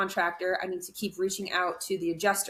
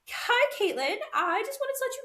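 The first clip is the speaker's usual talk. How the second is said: sugary fake-friendly. Mocking the pushy insurance lady.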